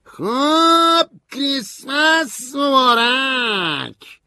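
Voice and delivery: loud, high, slow shouted greeting; shouting. A narrator's reading. Stanley yells Christmas greetings at narrator, shouting. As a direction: bellow it out, dragging each word. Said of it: "استنلی فریاد زد".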